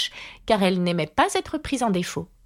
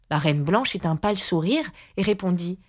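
ordinary delivery, nothing marked

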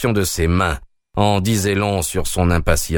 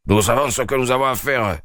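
majestic; 4 semitones higher than usual. Acted. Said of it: "dit majestueusement le brigadier".

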